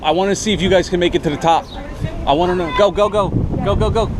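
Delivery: loud, excited cheering. Real, not acted. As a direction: call them on up the stairs. Cheering them up the stairs.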